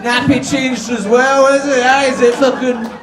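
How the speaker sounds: high-pitched taunting coo; mocking baby talk. Builders bullying a mate over chocolate milk, mocking baby talk. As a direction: tease as if cooing at a baby.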